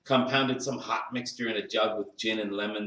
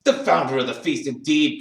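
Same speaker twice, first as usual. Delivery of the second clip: loud, scornful echo; indignant cry; 3 semitones higher, 6 dB louder than usual. A narrator's reading. Wife scoffs at Bob's toast to Scrooge, indignant. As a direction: throw the title back with indignation. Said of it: "cried Missus Cratchit, reddening".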